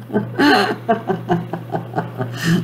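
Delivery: quiet, rising giggle; demo laugh. Shown as a demonstration. Host leads a laugh for the viewers. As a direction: let a soft giggle roll upward. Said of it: "I command you to laugh".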